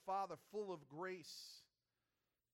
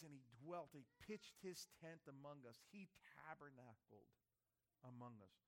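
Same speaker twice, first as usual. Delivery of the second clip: hushed; 5 semitones lower, 10 dB quieter than usual. Real, not acted. Preacher hushing the church as Christ dwells among us. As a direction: let the congregation lean in. Tender retelling of incarnation.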